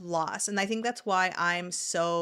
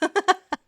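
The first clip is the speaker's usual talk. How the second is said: quick high burst of laughter; short laugh; 11 semitones higher, 8 dB louder than usual. Real, not acted. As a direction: laugh at your own joke. Laughs at own advice.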